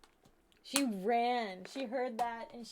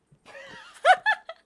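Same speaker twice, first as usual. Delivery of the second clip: loud burst of laughter; 14 dB louder than usual. Real, not acted. Dog runs in suddenly.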